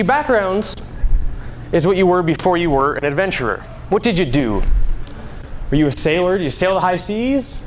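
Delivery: ordinary delivery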